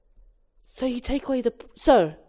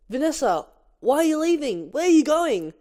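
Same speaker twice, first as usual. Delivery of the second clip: urgent higher pleading; 8 semitones higher than usual. Acted. Barry calls after Vanessa.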